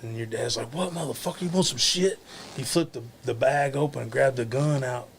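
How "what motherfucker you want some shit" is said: higher-pitched confrontational challenge; mock threat. Storyteller voices dad pulling a toy gun, mock threat. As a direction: imitate a man picking a fight, voice pitched up.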